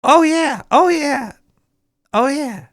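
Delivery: high, loud, repeated oh yeah; excited oh yeah. Real, not acted. Vlogger hyping himself up for his stories, excited. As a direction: ride a rising wave of excitement.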